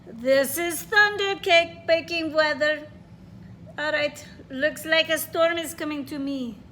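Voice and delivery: put-on grandma in a Russian accent. Teacher reading grandma's words to the class in a Russian accent. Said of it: "I'm trying to read with a Russian accent".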